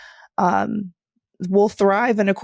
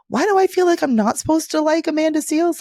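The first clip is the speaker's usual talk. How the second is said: puzzled question; 10 semitones higher than usual. Retold or imitated. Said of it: "but I'm like".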